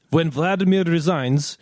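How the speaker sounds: hammed-up Russian accent joke. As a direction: ham up a Russian accent for the punchline. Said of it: "in a Russian accent".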